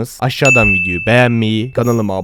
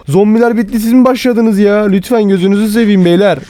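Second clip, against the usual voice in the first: high-pitched, exasperated begging; whining protest, pitched high; exasperated pleading; 8 semitones higher than usual. Pretend. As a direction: plead with them to lay off. Begs new attackers to stop.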